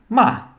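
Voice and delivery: ma falling sharply from high; fourth tone. Shown as a demonstration. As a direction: cut ma down fast from the top. (ma no quarto tom, descida brusca)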